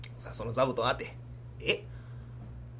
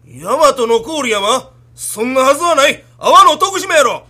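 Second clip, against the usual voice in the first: rising angry yell, much louder; slow, then snap it out louder and higher; angry shout; 5 semitones higher, 12 dB louder, slower than usual. Acted. Wife yelling at the pilgrim child, growing angry shout.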